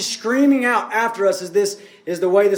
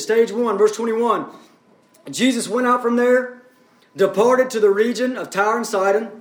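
normal talking voice

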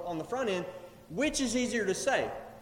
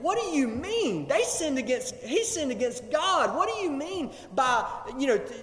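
acted-out incredulous challenge, pitched higher; higher-pitched, indignant questioning; objecting scribes; 4 semitones higher than usual. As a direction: object in disbelief, as an offended onlooker. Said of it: "they're standing off to the side and they're saying".

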